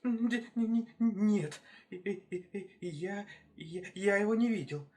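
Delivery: high, stuttering little hare; timid, halting high voice; frightened stammer. Acted. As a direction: stammer and trip over each first word.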